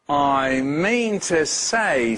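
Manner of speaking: very slow, weighty words; deliberate. Acted. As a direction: say it slowly like a spell. Nate repeats his trick.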